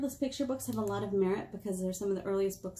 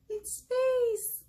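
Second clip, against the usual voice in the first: amazed discovery. Acted. Librarian revealing the page to kids with wonder. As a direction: gasp then high reveal.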